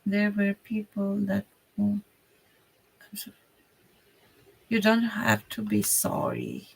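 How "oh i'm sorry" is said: ordinary talk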